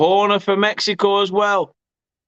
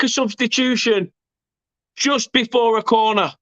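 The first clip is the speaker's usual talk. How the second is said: raised, insistent pitch after the goal; exclaiming vindication; 5 semitones higher than usual. Real, not acted. Commentator reminding viewers of his substitution warning, worked-up vindication. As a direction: insist you called it, voice still high.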